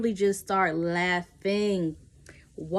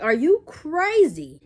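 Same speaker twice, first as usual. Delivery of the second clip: incredulous; 7 semitones higher, 6 dB louder, slower than usual. Retold or imitated. Disgusted at threesome offer.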